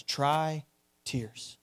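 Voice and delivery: soft, low, solemn words; hushed telegram. Retold or imitated. William Booth's reply to the ladies, low and hushed. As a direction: say it quietly and gravely. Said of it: "He says".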